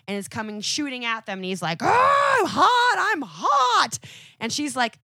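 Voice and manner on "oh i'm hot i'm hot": high, loud yelp of a man on fire; mock panicked shout. Johnny yelling that he's on fire, high and loud. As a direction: shriek it like you're burning up.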